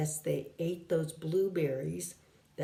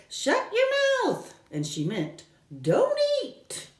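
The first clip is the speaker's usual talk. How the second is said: much higher quoted bird voice; bird's words. Retold or imitated. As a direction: echo the bird's squeak. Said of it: "She said".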